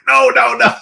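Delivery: high-pitched imitated protest; mock alarmed protest. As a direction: act out a hurried, high plea. Retold panicked protest at gunpoint.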